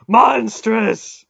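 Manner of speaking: high, full-force bellow; god thundering in fury; outraged roar. A narrator's reading. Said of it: "Mara roared".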